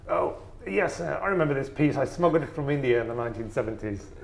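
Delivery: high, loud posh English collector voice; posh eccentric English collector. Retold or imitated. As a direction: impersonate an old upper-class English eccentric. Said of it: "that was a really cool accent you did there".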